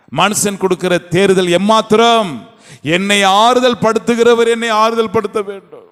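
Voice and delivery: rising, urgent sermon voice; fervent preaching. Acted. Preacher to congregation, fervent and rising. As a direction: preach it with mounting conviction.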